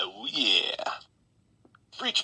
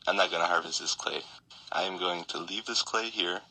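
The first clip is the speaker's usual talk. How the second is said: normal talking voice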